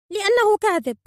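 very high, loud bird voice; high, indignant cry; accusing phoenix. A narrator's reading. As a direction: burst out with the accusation. Phoenix accuses the old man.

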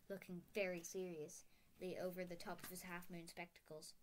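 plain reading voice

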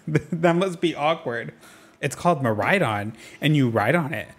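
ordinary talk, nothing marked

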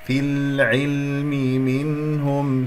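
slow, sustained Quran recitation; measured chanted recitation; tajweed recitation. Shown as a demonstration. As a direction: recite slowly, holding each long vowel. Recitation model in tajweed lesson.

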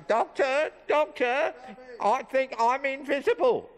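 the dummy telling a joke; squeaky ventriloquist dummy. Acted. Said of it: "he's gonna tell us a few jokes".

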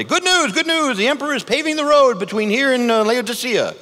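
hammed-up town crier announcing news. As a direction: cry it out to the whole town square. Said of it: "the town crier, and say".

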